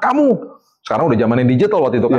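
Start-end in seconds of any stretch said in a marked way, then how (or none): 0.0-0.3 high, sharp mock reprimand; retold angry parent. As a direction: re-enact scolding your own children. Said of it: "saya pernah marah kayak begitu".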